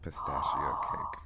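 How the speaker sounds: soft breathy whisper. Real, not acted. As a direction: whisper it to yourself. Echoing the dreamy cake idea.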